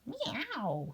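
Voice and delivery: soft, slightly high meow; lightly voiced cat meow. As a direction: mew like a cat. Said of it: "said Wilbur".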